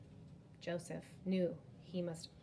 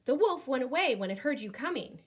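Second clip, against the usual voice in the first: quick, higher-pitched fib; boy lying to the village men, quick and breezy; boy's excuse; 6 semitones higher, 7 dB louder, faster than usual. Acted. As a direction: rush out the lie. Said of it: "Joseph said".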